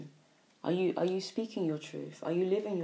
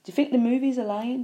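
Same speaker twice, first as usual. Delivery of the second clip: heated challenge; 6 semitones higher, 9 dB louder, faster than usual. Real, not acted. Challenging the listeners' doubts.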